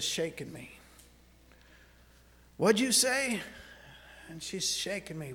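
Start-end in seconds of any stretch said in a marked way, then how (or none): 2.6-3.4 startled, louder question from a woken wife. Retold or imitated. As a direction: jolt awake and demand an answer.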